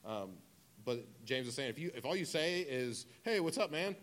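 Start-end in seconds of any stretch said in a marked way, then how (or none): 3.2-4.0 casual greeting. Acted. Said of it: "just a way of saying".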